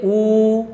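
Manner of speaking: "ऊ" as a long, held oo; demonstrated vowel. Shown as a demonstration. Teacher modelling a back vowel for students, demonstrated vowel. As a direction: sustain "ऊ" on a level pitch. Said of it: "उसके बाद बोलिए".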